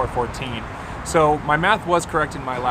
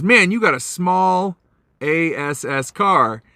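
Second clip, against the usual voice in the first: slow, drawn-out retold jibe, pitched up; mocking stranger; 6 semitones higher, 3 dB louder, much slower than usual. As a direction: imitate a pushy stranger's taunt, stretching the words. Retold taunt from rude stranger.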